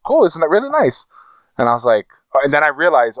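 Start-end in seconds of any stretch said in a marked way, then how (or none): 0.1-1.0 high, impressed mock voice; hammed-up impressed friend; mock admiring. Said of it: "he's like".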